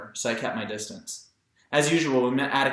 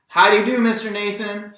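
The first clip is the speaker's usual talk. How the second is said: much higher and louder, bursting with eagerness; eager greeting; 9 semitones higher, 9 dB louder than usual. A narrator's reading. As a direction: call the greeting out, keen and loud. Jem eagerly greets Mister Nathan.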